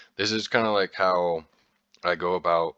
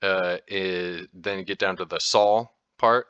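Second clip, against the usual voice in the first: ordinary talk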